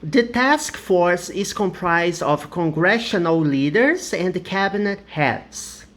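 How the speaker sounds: slow, clear English reading; teacher reading an example aloud; slow model sentence. Shown as a demonstration. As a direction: read the example carefully. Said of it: "The next example".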